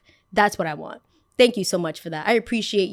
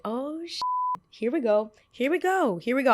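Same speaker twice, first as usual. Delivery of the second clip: smug hater; 5 semitones higher than usual. Retold or imitated. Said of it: "the hater in me started smiling so big".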